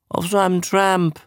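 high-pitched, noncommittal reply; evasive. A narrator's reading. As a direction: dodge the question, pitch lifted. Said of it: "sagte Dingle ausweichend".

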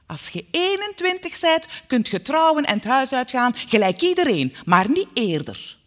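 loud, bossy decree; forceful maternal ordering; commanding mother. A narrator's reading. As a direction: lay down the law at full voice. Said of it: "bleef commanderen".

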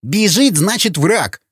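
heated, high-pitched snarl; angry retort. Acted. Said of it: "зло прорычал Сквернослов". (злое рычание на повышенном тоне)

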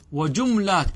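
وجملات said "wa-jumlāt", no vowel after the m; sukun on the mim. Shown as a demonstration. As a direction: close the syllable on m in وجملات. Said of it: "بالسكون". (وجملات بسكون الميم)

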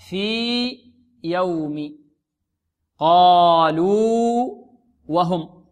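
في يوم، قالوا وهم drawn out with a break after each long vowel; very slow, hold في and قالوا; separated long vowels. Shown as a demonstration. Teacher quoting the examples, separated long vowels. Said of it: "أظهر".

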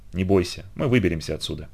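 soft, low comforting tone; reassuring. A narrator's reading. Horse nuzzles the homesick boy, reassuring. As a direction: soothe gently and quietly.

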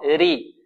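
"ري" with a thin, light ر. Shown as a demonstration. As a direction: say "ري" without any heaviness. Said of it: "وترقي إذا كانت مكسورة". («ري» براء مرققة)

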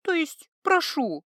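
slow, gentle correction. Acted. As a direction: soften it right away. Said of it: "на более мягкую".

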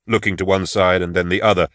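plain reading voice

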